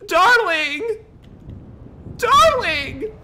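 high, drawn-out dramatic wail; distressed calling out. Acted. Telling friend they were evicted, high distressed calling. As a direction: call out shrieking with bad news.

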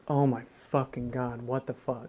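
quiet, low, exhausted cursing; muttered despair. Retold or imitated. Sitting alone as the pain returns, muttering. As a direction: mutter it under your breath in pain.

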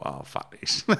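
unvoiced whisper to himself; whispered thought. To himself after the pitch, in a whisper. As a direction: mutter it under your breath.

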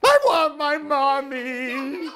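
put-on baby owl crying for his mother. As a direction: wail it like a tiny owl wanting Mommy. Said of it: "cried Bill".

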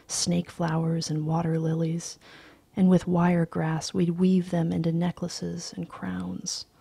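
ordinary delivery

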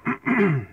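clearing a frog from the throat; hard cough to clear the throat. Real, not acted. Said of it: "excuse me, I got something in my throat".

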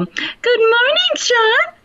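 mock the cheery neighbor's greeting; the neighbor's chirpy drawn-out hello; imitating neighbor Bob. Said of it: "and he'll go".

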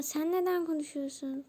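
ordinary delivery

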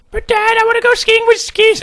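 put-on very high child voice; little son pleading. Son objecting to Dad's ski plan, high-pitched pleading. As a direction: plead in a squeaky kid's falsetto.

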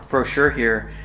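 ordinary talk

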